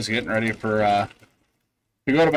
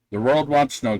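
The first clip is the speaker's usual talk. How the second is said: usual speaking voice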